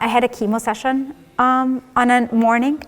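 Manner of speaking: ordinary talk, nothing marked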